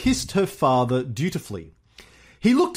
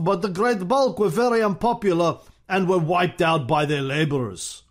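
Stalin impression; 4 semitones higher than usual. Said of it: "Stalin replied".

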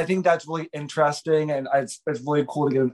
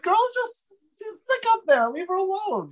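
much higher mock-exasperated voice; high exasperated aside to a friend on TV; exasperated quip. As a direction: squeal it like talking to the screen. Talking back at the TV scene.

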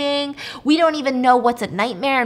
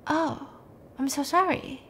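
soft, higher condolence; acted sympathy; 5 semitones higher, 5 dB quieter than usual. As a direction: hush and lift the voice in retold sympathy. Nurse hears wife is missing.

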